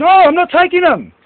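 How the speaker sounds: sharp refusal. Real, not acted. Refusing the offered drink.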